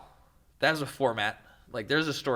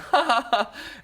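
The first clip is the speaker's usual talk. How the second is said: high amused laugh; 12 semitones higher, 5 dB louder than usual. Real, not acted. Laugh after mock alarm.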